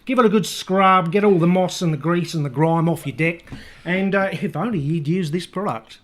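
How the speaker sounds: bad Australian selling deck cleaner; bad Australian accent. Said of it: "very bad Australian accent".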